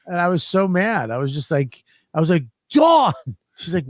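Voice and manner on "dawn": sharp high yell of her name; calling out. Retold or imitated. Sick man yelling for his wife, loud high call. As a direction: shout across the house for her.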